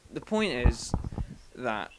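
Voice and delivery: usual speaking voice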